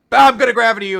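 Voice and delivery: loud, high mock villain line; gleeful mock villain threat. Villain Ziggy quoted in recap.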